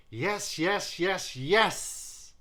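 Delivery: high, loud, joyful yeses; shouted yes. Acted. Judge shouting her vote to the singer. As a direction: shout it out over and over. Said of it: "hear her shout that".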